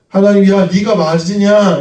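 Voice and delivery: God loudly asking Jonah if he is right; voicing God. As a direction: call out the question to Jonah as God.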